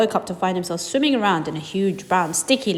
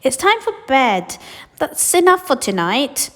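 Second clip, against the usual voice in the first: higher, slow motherly call; 6 semitones higher, 4 dB louder than usual. Acted. Mother calling Charlie to bed, slow gentle voice. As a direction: gently send him to bed.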